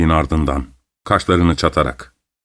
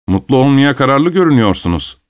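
higher-pitched, impulsive remark; mildly provoked, pitched up; unable to hold back; 6 semitones higher than usual. A narrator's reading. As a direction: blurt it out. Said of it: "dedi kendini tutamayarak".

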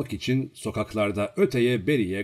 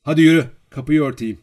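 loud, higher, impatient dismissal; cutting him off; 5 semitones higher, 10 dB louder, faster than usual. A narrator's reading. Girl interrupting the bragging servant, impatient order. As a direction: interrupt and send him away. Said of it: "diye kesti onun sözünü".

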